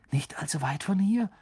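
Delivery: gehauchtes, hohes Echo als Frage; vielsagendes Nachfragen. A narrator's reading. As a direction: bedeutungsvoll wiederholen, halb geflüstert. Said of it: "wiederholte Ron und blickte Harry vielsagend an". (vielsagend fragend)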